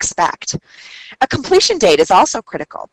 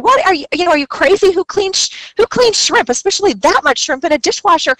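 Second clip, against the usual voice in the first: higher, exasperated mock anger; a little angry; 8 semitones higher than usual. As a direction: retell the manager's outburst, pitched up in disbelief. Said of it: "she got a little angry".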